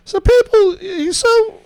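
high-pitched British character voice; British accent. Acted. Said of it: "but a British accent nonetheless".

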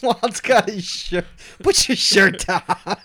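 can barely get it out for laughing. Real, not acted. Laughing at friend lifting shirt.

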